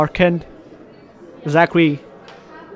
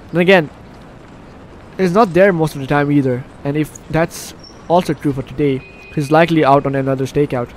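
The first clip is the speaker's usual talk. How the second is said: ordinary talk, nothing marked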